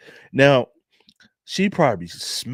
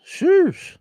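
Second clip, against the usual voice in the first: high-pitched sheesh; 4 dB louder than usual. Real, not acted. Reacting to photo, high far exclaim.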